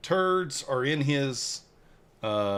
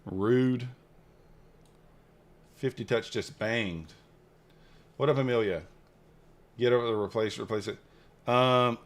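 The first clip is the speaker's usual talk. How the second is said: ordinary talk, nothing marked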